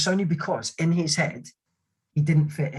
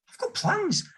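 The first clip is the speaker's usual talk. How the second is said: much higher, petulant put-on voice; mock whiny protest; 4 dB quieter, slower than usual. Soldier dodging suicide watch duty, high whiny protest. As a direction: whine it like a sulky kid. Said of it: "And I was like".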